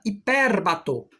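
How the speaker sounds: hipérbato, slow, leaning on the É syllable; stress on the E. Shown as a demonstration. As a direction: draw out hipérbato with weight on the É. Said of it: "com um grande acento agudo no E".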